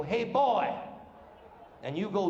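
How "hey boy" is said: mock Southern highway patrolman calling out; louder, higher imitation of an Alabama lawman. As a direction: pitch it up like a Southern cop hailing a driver. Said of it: "they stop your car and they go".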